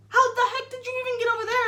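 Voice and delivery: high, fast, incredulous mom; mom's disbelief. Retold or imitated. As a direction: fire it off like a shocked mother. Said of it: "and she's like".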